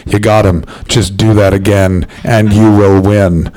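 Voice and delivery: low, deliberate imitation of a cornerman; hint of trainer. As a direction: drop low for the coach's instruction, slowing to the end. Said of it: "Tony, my trainer, looks at me and went".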